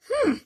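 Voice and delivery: high, drawn-out snooty hmm; a sniffy elephant hmm; haughty hmm. Acted.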